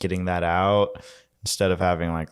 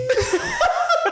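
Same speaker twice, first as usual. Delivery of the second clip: laughing. Real, not acted. Laughing at the dab story.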